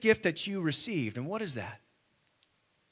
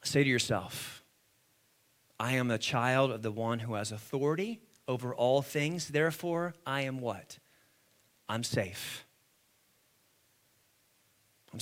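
low, soft words of assurance; hushed preaching; 9 semitones lower, 6 dB quieter than usual. Real, not acted. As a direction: lead a quiet personal affirmation.

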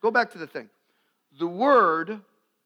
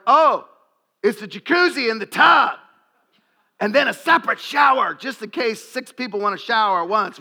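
mock excited guest; 3 semitones higher, 5 dB louder than usual. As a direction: rave like a hotel guest showing off. Mock hotel guest raving.

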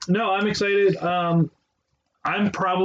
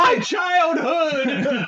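slow, high, laughing mock cry; drawn-out laughing mock shout; mock wail; 9 semitones higher, much slower than usual. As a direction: ham up a wail of lost childhood. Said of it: "I said that".

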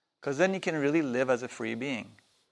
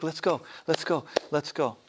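put-on higher, urging inner child voice; eager little boy; 3 semitones higher than usual. Inner little boy urging him to ride cross country, eager and higher. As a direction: nag like an impatient kid. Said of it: "The little boy's like".

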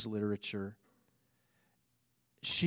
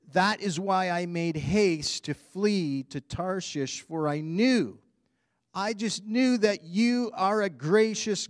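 dramatic scripture reading; 5 semitones higher, 4 dB louder than usual. Acted. Preacher reading Jonah's prayer to congregation, dramatic. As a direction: read Jonah's grievance with feeling.

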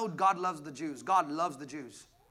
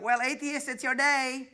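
lightly voiced smug poster, higher; 7 semitones higher, 4 dB louder, slower than usual. Pastor mocking Christians' April Fools posts, sarcastic. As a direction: parody a preachy Facebook post.

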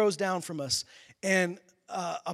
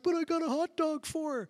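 higher, innocent mock voice of the hot dog guy; clueless fan; 9 semitones higher than usual. Imitating the fan who offers the hot dog, clueless mock voice. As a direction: play him sweetly oblivious. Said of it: "he's like".

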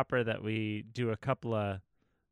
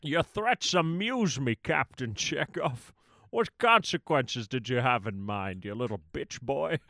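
Connery voice. Pretend. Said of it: "Sybok a la Connery".

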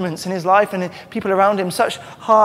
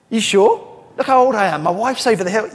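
doubting Zechariah; 5 semitones higher, 6 dB louder than usual. Acted. Preacher voices doubting Zechariah.

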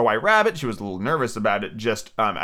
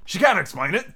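quick interjection, pitched up; hasty; 6 semitones higher than usual. A narrator's reading. Griffin cutting in on the Mock Turtle, hastily. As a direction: jump in hastily. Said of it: "said the Griffin hastily".

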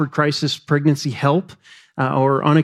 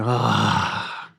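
put-on soft, low aww over a baby; adoring group sigh, demonstrated; cooing sigh; 8 semitones lower, 6 dB quieter than usual. Shown as a demonstration. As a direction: let out a hushed, low "ah". Models crowd's coo over baby.

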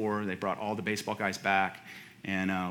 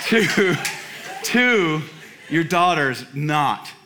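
louder, higher, slow punchline; a pastor's comic inner rant, louder and drawn out; emphatic inner rant; 6 semitones higher, 7 dB louder, much slower than usual. Retold or imitated. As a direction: build the joke word by word. Inner thought, building punchline.

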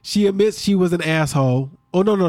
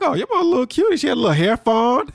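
high-pitched retold gushing over a cute picture; admiring coo; 9 semitones higher, faster than usual. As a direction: coo it much higher than your usual voice. Admiring the mom's old picture.

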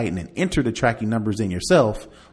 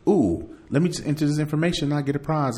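higher, lightly voiced naive clicker; mock gullible victim; 6 semitones higher, faster than usual. Imagining a scam victim, mock gullible. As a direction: play the eager dupe. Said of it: "I can imagine people".

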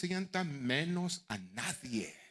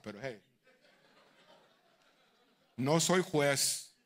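ordinary talk, nothing marked

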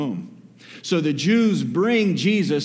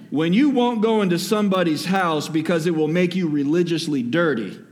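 preaching; 4 semitones higher than usual. Acted. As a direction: drive the point home to the church. Preaching at full force.

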